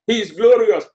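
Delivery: ordinary delivery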